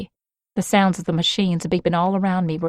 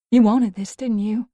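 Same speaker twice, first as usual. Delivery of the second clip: bitter accusation; 4 semitones higher, 3 dB louder than usual. Acted. Mia accusing Creed.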